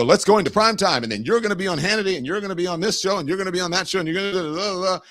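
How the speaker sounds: brisk mock TV producer, fast; lightly voiced producer rattling off bookings. Producer voice planning shows.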